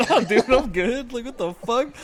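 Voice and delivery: high, slow, laughing through the no; laughing refusal. Retold or imitated. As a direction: turn him down while cracking up. Retelling refusal while laughing.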